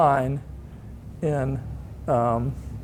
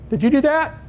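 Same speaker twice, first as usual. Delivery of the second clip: high West Texas boss demanding an answer; John Roach West Texas voice; 10 semitones higher than usual. Retold or imitated. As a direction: pitch it up like the Texan boss grilling him. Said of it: "he kinda talked like this".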